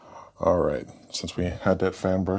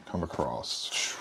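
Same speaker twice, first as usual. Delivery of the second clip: slow, soft drawn-out; 5 semitones lower, 8 dB quieter, much slower than usual. Shown as a demonstration. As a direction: stretch it out low and quiet. Repeats brush instruction slowly.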